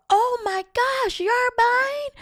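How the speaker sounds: very high, breathy whispered gasp; women gushing at a friend paying, very high; mock surprised squeal.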